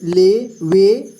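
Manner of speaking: ले वे said very slowly with the vowel held; long vowel drawn out. Shown as a demonstration. Teacher reading the alphabet to students, long vowel. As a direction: drag out the vowel in ले and वे. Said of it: "ज़्यादा देर तक इसका उच्चारण करना है".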